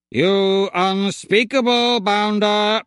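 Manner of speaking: slow, indignant robot buzz; outraged machine voice, slowed right down. A narrator's reading. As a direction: drag it out like an offended droid. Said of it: "he buzzed at me".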